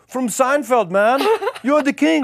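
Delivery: high, loud put-on voice of an adoring stranger; high, loud impression of a drunk Dutch fan hailing a celebrity; imitating a fan. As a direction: gush it like a drunk starstruck fan. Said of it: "He goes".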